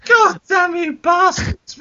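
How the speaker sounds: slow, high-pitched outburst; exasperated shout. Acted. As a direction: curse the whole cast in exasperation. Exasperated host scolds the cast.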